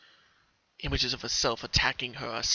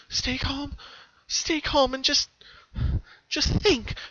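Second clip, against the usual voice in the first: put-on much higher girlish voice; pony repeats calming words to herself; telling herself to stay calm; 13 semitones higher, slower than usual. A narrator's reading. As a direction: breathe and repeat it to yourself.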